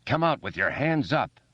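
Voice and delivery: mock policeman, commanding; low commanding adult voice. A narrator's reading. Bill playing a cop to the kids below, commanding. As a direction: play the stern cop. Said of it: "in a low, commanding adult voice".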